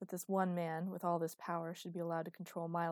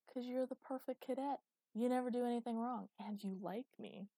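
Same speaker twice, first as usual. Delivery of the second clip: a hint of hammed-up flirting, higher voice; mock seduction; 6 semitones higher than usual. Cadet coaxing her to hide him, playful mock seduction. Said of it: "an attempt at ridiculous seduction".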